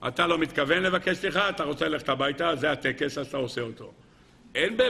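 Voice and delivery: normal talking voice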